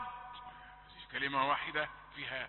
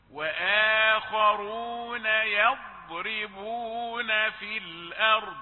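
very slow, drawn-out chant; Quranic recitation; 4 semitones higher, 5 dB louder, much slower than usual. Acted. Teacher recites the verse for students, slow chanting. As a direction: recite as in prayer.

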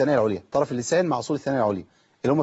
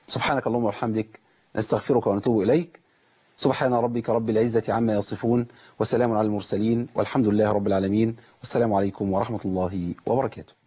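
calm, lower recited supplication; closing prayer; 4 semitones lower than usual. Acted. Presenter closing the show with a prayer.

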